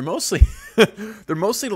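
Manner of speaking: laughing while getting the words out. Real, not acted. Host cracks up explaining his string lights. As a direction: giggles breaking through the words.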